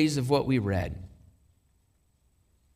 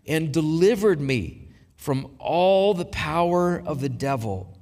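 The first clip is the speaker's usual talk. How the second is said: measured catechism recitation, long pauses between phrases; slow, deliberate reading. Real, not acted. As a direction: read it slowly so listeners can absorb it. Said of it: "I'm gonna read this slowly".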